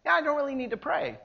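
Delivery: high, soft, quick brush-off; breezy, unconcerned excuse; airy dismissal. Acted. Mimics someone declining to pray.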